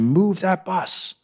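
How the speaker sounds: high, drawn-out mock scream at no extra volume; high-pitched imitation of a shouting crowd; imitated crowd scream. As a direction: stretch it out like a held-back crowd chant. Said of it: "they would scream".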